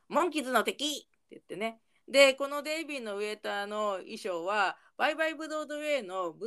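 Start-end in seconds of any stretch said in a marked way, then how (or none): 0.1-0.8 higher, mild mock shout; retold group shout. The band shouting together at the manager, mild mock cry. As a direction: call it out like an accusing chorus, lightly acted. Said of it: "一斉にモンキーズ叫びます。".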